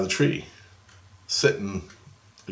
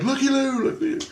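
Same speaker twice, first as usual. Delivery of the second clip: very high, playful calling voice; high singsong call. Retold or imitated. Calling to unseen spirits in the building, high singsong.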